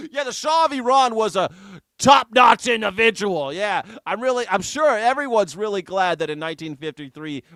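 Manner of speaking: loud, high mock enthusiasm; sarcastic praise. Host answering a viewer who defends the Shah, loud sarcasm. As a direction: gush with hammed-up sarcasm, a laugh at the start.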